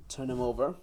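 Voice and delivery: ordinary talk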